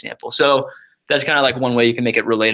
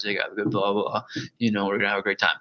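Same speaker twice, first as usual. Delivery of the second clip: bored radio ad; 3 semitones lower, 6 dB quieter than usual. Shown as a demonstration. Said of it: "you don't hear 'em like".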